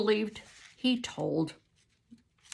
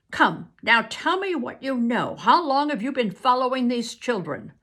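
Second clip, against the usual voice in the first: louder, higher stern questioning; severe; 6 semitones higher, 7 dB louder than usual. Acted. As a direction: interrogate him sternly. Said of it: "spoke severely".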